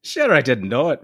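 high-pitched, heated stage-Irish; acted indignant Irish comeback; hot retort. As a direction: fire back in an Irish brogue. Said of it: "returned Andy hotly".

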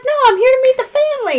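high, fast protest; acted stubborn reply; retold refusal. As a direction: insist brightly on staying. Guest cheerfully refuses to leave.

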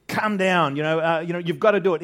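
"Come down" urged loud and high; emphatic demand. Acted. Preacher acting out the nobleman's insistence, loud and urgent. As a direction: press hard and loud on "Come down" and "gotta do it". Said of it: "There's two emphatic".

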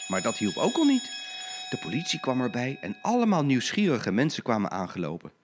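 ordinary delivery

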